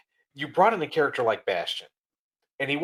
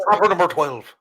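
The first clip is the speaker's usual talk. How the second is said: Irish accent impression; 7 semitones higher, 11 dB louder than usual. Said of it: "Oh, do ya?".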